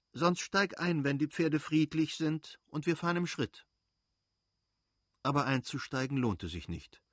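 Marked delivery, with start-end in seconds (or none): 0.1-3.7 calling out. Acted. Mother keeps calling from carriage.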